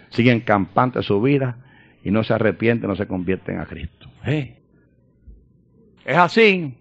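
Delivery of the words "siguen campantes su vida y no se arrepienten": normal talking voice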